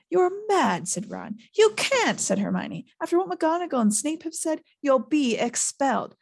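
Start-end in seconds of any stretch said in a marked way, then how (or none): 0.1-0.9 incredulous. A narrator's reading. Ron protesting. 1.6-6.1 alarmed protest. A narrator's reading. Hermione protesting.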